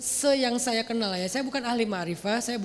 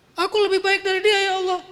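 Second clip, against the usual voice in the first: higher, louder boastful retort; arrogant Iblis; 9 semitones higher, 7 dB louder than usual. Acted. Iblis boasting to Allah, arrogant and loud. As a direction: boast it defiantly.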